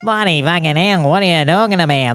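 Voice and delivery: high, broad nasal Australian twang; Australian accent. Shown as a demonstration. Demonstrating Australian accent, high nasal outburst. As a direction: exclaim in exaggerated Australian, high in the nose. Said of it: "that's how you do an Australian accent".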